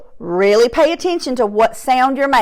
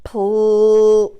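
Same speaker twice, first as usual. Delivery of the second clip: normal talking voice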